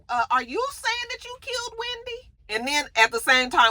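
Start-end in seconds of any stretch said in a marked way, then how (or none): 0.1-2.3 high, incredulous mock voice; stunned question. Daniel questioning Kristen, high stunned disbelief. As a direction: ask it way up in disbelief. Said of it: "He was like".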